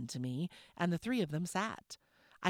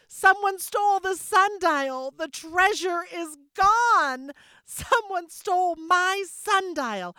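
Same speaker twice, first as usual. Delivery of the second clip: high, loud, slow frantic shout; 10 dB louder, slower than usual. Acted. Mama crying out to the family about her stolen sundial, slow high shout. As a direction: keep shouting it, stretched out and desperate. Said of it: "Mama shouted".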